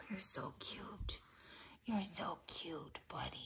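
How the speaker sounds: soft unvoiced whisper to the cat; tender whisper. Real, not acted. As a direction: whisper it lovingly, barely audible.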